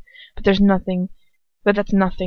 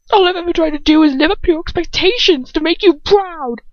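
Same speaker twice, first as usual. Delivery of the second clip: much higher and louder, voice wavering out of control; wobbling voice; 10 semitones higher, 6 dB louder than usual. A narrator's reading. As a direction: fight to keep a breaking voice steady. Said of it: "His voice wobbles all over the place".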